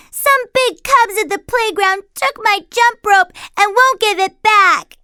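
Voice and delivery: put-on high, loud child's complaint; upset little cub. Little sister complaining about the big cubs. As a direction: tattle to Mama. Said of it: "Sister said".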